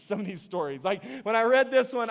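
ordinary talk, nothing marked

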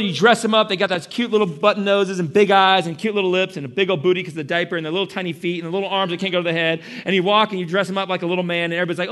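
usual speaking voice

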